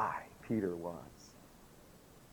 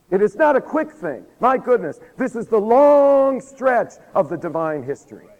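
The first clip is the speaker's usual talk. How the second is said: loud, high, insistent preaching; full-force preaching; 7 semitones higher, 7 dB louder than usual. Real, not acted. Preacher on the divine history, raised and emphatic. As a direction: drive each phrase home with raised voice.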